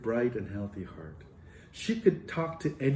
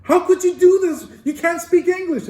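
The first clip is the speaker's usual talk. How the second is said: much higher and louder, laughing in astonishment; can't believe it, half laughing half exclaiming; laughing disbelief; 10 dB louder than usual. Retold or imitated. Professor to student who traveled without English, astonished laughter.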